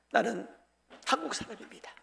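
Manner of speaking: very soft, solemn; quiet, heartfelt quote; hushed quoted declaration. Acted. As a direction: drop almost to a murmur for the declaration. Acted quote repeated, hushed.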